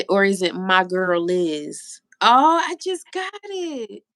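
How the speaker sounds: usual speaking voice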